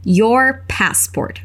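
Your passport, stretched out very slowly; slow model. Shown as a demonstration. Teacher showing the learner the phrase, very slow. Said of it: "ela diz o seu passaporte".

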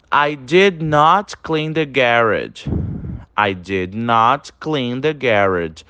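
each English word said slowly and evenly; very slow model reading. Shown as a demonstration. Teacher reading the past example to students, very slowly. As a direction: read it slowly for repetition. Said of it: "Olha aí as frases de exemplo".

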